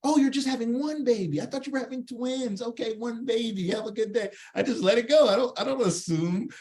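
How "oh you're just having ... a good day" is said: high, over-understanding mock sweetness; hammed-up friendly send-off; mock goodwill. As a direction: act innocently impressed, pitched up. Acted forgiving reply to rude driver.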